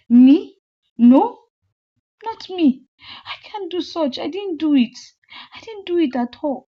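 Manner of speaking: frantic denial. Acted. Accused friend denying loudly.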